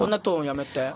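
deep mock grave tone. Mocking the serious tone.

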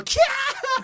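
high, slow, loud squeal; shriek. Retold or imitated. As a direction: squeal it like a startled fan. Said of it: "キャーってやるから".

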